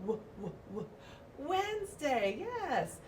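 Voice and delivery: gentle phonics demo; letter sound w. Shown as a demonstration. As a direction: sound out w before the word. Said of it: "same sound as willow".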